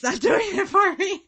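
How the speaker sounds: high, loud, breaking up with laughter; helpless giggle through the words; laughing while talking. Real, not acted. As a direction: crack up mid-sentence and laugh the words out. Cracks up over the cheesy accent.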